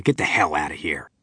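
rough soldier snapping at Billy; angry order. Acted. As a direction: throw him out. Prisoner rudely ejects Billy.

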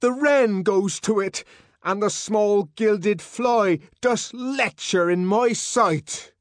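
loud, high, slow Shakespearean rant; raving declaimer. A narrator's reading. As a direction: declaim verse like a maddened marcher. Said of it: "marching to the drums and music of magical words".

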